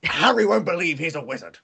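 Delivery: mock-serious Vince, tossed off fast; imitating Vince McMahon. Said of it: "I'm Vince McMahon".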